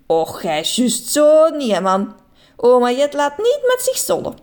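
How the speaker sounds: mock-scold him; playful granny scolding. A narrator's reading. Grandma teases in dialect.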